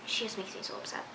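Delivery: hushed, unvoiced venting; exasperated whisper. Real, not acted. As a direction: whisper it, worn out by her. Venting frustration at a character.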